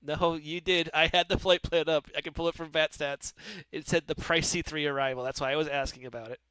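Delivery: ordinary talk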